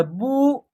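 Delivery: बू with a held ऊ; long vowel. Shown as a demonstration. Teacher showing ब with the matra, बू held long. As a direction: draw out the vowel in बू. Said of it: "आप long तरीके से उच्चारण".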